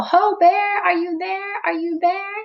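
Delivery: high, ringing call across the woods. Acted. Friends searching the wet forest, calling for Bear. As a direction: call out to find the lost bear. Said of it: "They call".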